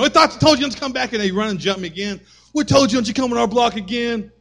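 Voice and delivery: high, loud bully taunt; taunting bullies. Retold or imitated. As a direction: sneer it like a street tough.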